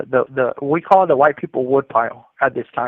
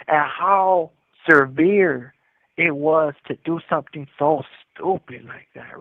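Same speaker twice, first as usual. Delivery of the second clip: ordinary delivery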